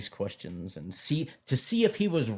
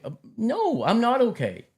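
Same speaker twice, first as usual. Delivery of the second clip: exasperated retort; 11 semitones higher, 4 dB louder than usual. Pretend. Retort to church's reassurance.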